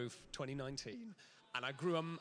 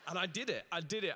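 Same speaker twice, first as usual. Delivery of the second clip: ordinary talk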